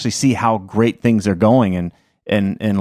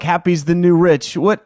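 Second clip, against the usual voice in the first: ordinary talk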